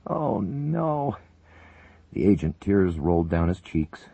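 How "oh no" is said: devastated. Acted. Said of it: "Dmitri cried, totally devastated".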